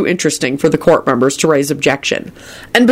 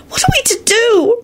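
dramatic shriek. A narrator's reading. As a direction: wail it melodramatically. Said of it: "Rosie shrieked dramatically".

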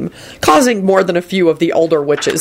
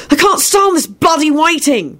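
high-pitched exasperated outburst; angry shout; 13 semitones higher than usual. A narrator's reading. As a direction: burst out angrily, out of patience. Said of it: "William shouted angrily".

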